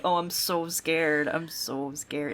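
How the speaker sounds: slow sarcastic mock fear; a sneering mock of the brothers' bravado. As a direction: drawl it out, mocking their fake fright. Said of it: "Like, like".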